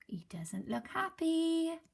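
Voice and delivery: slow whispered aside. Real, not acted. Teacher commenting on the cat, whispered. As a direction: breathe it sympathetically.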